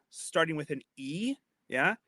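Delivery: usual speaking voice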